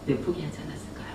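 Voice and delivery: ordinary talk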